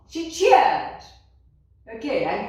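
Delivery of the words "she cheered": higher, louder, bright cheer; cheerful demo. Shown as a demonstration. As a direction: lift the word up like a cheer. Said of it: "let us look at somebody who is happy".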